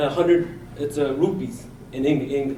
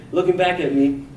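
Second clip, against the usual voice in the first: ordinary delivery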